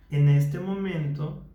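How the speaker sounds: slowed down, each word placed with care; slow, mindful speech. Shown as a demonstration. Therapist demonstrating mindful speech to a client, slow and deliberate. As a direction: speak slowly, listening to your own voice. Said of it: "estoy siendo consciente y estoy escuchando cómo hablo".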